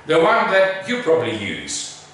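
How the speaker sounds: usual speaking voice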